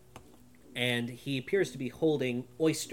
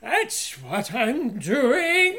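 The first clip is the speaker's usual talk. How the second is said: high, slow, loud wail of protest; indignant old man; 13 dB louder, much slower than usual. Acted. Edgar protests to Isabel, high loud wail. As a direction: whine it like a put-upon old man.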